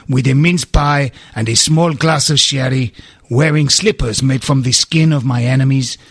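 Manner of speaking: calm mock Portuguese footballer accent; Luis Boa Morte accent. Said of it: "by attempting the accent".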